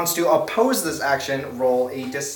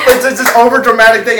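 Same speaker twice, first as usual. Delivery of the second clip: usual speaking voice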